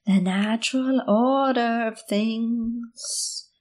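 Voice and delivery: slow, creepy crone voice; drawn-out witchy whisper; creepy little voice. Pretend. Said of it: "I like that little voice you did".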